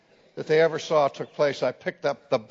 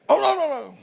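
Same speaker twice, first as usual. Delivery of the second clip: high, hammed-up oh no no; mock student dismay; 12 semitones higher, 5 dB louder, much faster than usual. Students groaning at the professor's final warning, high mock dismay. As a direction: whine it like a caught student.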